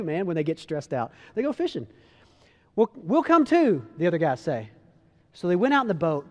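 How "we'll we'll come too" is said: louder, higher, drawn-out reply; lightly acted group holler; retold eager call. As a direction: call after a friend to join in. Disciples call after Peter.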